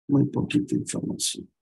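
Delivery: breathy whisper under her breath; a disappointed whisper to herself; whispered aside. Real, not acted. Teacher whispers to herself that the file is too small, soft and breathy.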